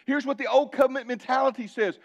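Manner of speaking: usual speaking voice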